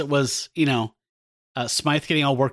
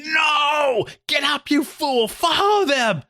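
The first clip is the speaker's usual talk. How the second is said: high, shouted villain commands, slowed on the fool line; villain yelling orders; 12 semitones higher, much slower than usual. Retold or imitated. Smythe yelling at the unconscious Spider-Man, high shouted villain voice. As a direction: bark at the fallen hero like a comic-book mastermind. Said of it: "Smythe ... going".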